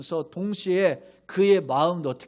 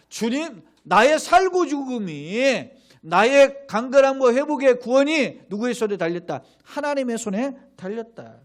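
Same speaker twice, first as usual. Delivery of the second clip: preaching emphasis; 6 semitones higher, 7 dB louder than usual. Real, not acted.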